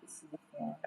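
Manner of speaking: whispered aside, unvoiced; whispering. Real, not acted. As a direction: breathe it as a secret.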